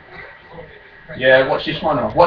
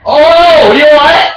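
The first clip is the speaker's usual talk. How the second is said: raging gamer; 6 semitones higher, 6 dB louder, slower than usual. Real, not acted. Mocking a fouled opponent.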